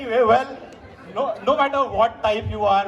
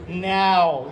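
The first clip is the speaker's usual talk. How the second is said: lower, put-on American vowel; fake American accent; 4 semitones lower than usual. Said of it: "that's the fake American accent".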